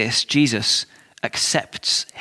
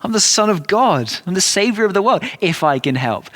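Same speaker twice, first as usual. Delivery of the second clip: higher-pitched, incredulous challenge, pushing up on the key claims; calling him out; 5 semitones higher than usual. Retold or imitated. Jesus answering the doubting father, incredulous. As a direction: pull him up short on his doubt. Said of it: "Jesus calls him up on it immediately".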